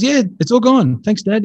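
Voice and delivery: put-on high, chirpy child's reply; imitated little girl. Little girl answers her dad after prayer, imitated chirpily. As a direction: play a relieved kid, pitched well up.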